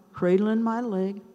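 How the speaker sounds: ordinary talk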